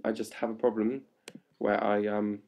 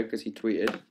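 ordinary talk

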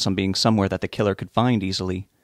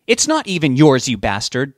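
irritated comeback, raised at the start; sharp retort; 4 semitones higher, 5 dB louder than usual. A narrator's reading. Betty corrects her husband, retorting. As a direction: retort hotly, then drop onto the insult. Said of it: "Betty retorted".

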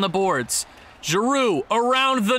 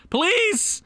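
please, high and pleading; loud plea; 11 semitones higher than usual. Real, not acted. Begging the team, a high loud please. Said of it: "pleading twice, second louder".

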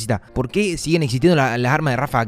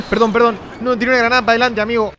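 laughing apology; 6 semitones higher, 5 dB louder, faster than usual. Real, not acted. Apologising after laughing at grenade.

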